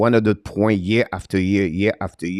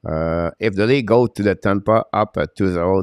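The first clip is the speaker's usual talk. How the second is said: ordinary talk, nothing marked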